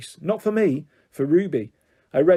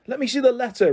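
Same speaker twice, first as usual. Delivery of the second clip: demanding; 9 semitones higher, 3 dB louder than usual. Acted. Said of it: "Ruby demanded".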